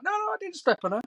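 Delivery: high squeaky protest; mock denial. Mate denying stepping on her, high whiny protest. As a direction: play the friend whining no.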